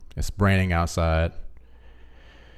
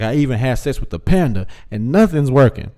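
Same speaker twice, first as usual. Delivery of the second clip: mock exasperated plea; 5 semitones higher, 5 dB louder than usual. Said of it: "He's like".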